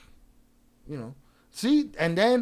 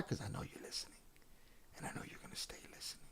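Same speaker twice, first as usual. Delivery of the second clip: soft, unvoiced, teasing whisper; taunting whisper; 21 dB quieter, faster than usual. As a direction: lean in and breathe it like a secret threat. Taunting a lurking viewer.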